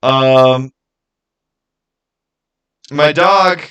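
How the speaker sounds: usual speaking voice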